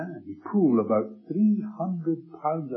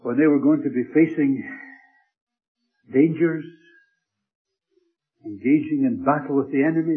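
preaching build; 8 dB louder than usual. Acted.

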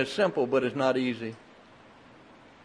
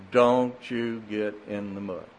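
stern warning; 5 semitones lower, 3 dB quieter than usual. Retold or imitated. Mother warning freshly cleaned son.

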